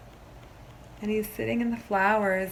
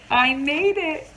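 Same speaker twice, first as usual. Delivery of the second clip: higher, louder joyful cry of success; proud triumph; 7 semitones higher, 10 dB louder, slower than usual. Acted. Teacher voicing Leo's first sentence to the class, joyful and louder. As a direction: burst out proud like a child who finally did it. Leo finally speaks in story.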